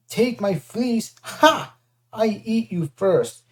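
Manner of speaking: slow gloating monster with a barked laugh; mocking giant. Cyclops gloats over trapped Percy, slow mocking. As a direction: taunt him before eating him. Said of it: "he bellowed".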